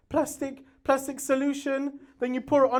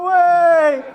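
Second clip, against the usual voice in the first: loud, high whoop; loud cheer; 5 semitones higher, 12 dB louder than usual. Retold or imitated. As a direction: cheer it like the neighbours.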